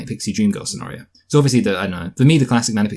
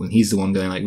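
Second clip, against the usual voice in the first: ordinary talk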